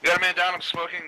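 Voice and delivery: urgent shout; man down shout. Real, not acted. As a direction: yell it out under fire. Teammate shot, calling it out.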